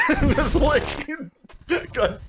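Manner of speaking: breaking up laughing mid-sentence. Real, not acted. Laughing at the double miss.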